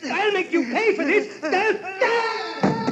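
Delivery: high, shrieking panic with a broken laugh; terrified pleading. Acted. Uncle fighting off Grace and Fred at the stairs, shrieking. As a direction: beg for life as they push.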